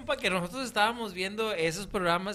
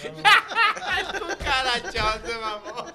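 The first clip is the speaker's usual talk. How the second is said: high, slow, laughing monster voice; cockroach monster voice; 4 semitones higher, 7 dB louder, much slower than usual. Pretend. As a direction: drag it out like a bug villain. Mocking a movie cockroach villain.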